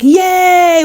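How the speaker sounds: high mock cheer. Mocking their cheer at the US offer, high, gleeful. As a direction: squeal a gleeful yay.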